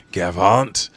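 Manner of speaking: loud, punched attack cry; attack call. Acted. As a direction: call out the sword move. Acted attack name called out.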